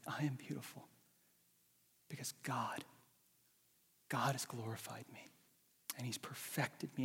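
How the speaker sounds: soft whispered confession; whispered self-affirmation. Pretend. Preacher voicing the listener speaking to themself in a whisper. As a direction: whisper it to yourself in wonder. You're gonna say.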